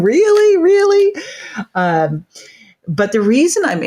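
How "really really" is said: high, loud incredulous really; mock disbelief. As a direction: squeal it in comic disbelief. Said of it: "camera around looking at this going".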